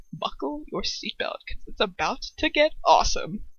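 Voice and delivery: ordinary delivery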